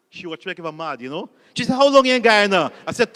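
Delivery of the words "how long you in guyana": loud demand. Retold or imitated. Lady interviewer barking at a nervous job applicant, loud. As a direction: boom it out like a stern interviewer.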